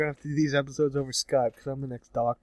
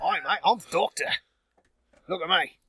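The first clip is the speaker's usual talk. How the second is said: higher-pitched, hammed-up Cockney; mock Cockney; 5 semitones higher than usual. Fake Doctor introducing himself, mock Cockney. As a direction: announce yourself in exaggerated Cockney. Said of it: "hardcore Cockney".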